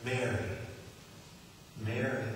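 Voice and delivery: soft, low, tender call of "Mary"; tender calling name. Acted. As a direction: call her name gently, like a shepherd. She knows who talks like that.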